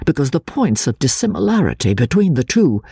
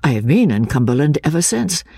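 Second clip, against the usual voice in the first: ordinary delivery, nothing marked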